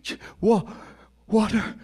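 parched, gasping plea. Acted. Collapsing slave begs for water.

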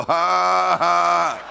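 shouted scornful ha ha; loud mocking laugh. Preacher laughing at the devil before the congregation. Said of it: "And you go".

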